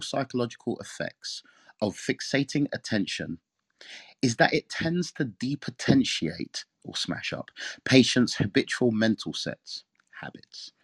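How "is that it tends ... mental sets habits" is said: slow, stressing the key words; deliberate slow emphasis; emphatic reading. Shown as a demonstration. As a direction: lean on each word, slowly. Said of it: "trying to emphasize certain words".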